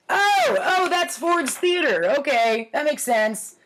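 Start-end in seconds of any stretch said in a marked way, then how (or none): 0.1-2.1 high, loud oh of recognition; sudden realization. Real, not acted. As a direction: suddenly get what the drawing is. Realizing what the drawing shows.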